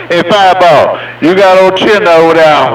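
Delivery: ordinary talk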